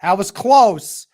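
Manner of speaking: steady game commentary; live play-by-play. Acted. Fan streaming the game, calling each play. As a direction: call the action as it happens.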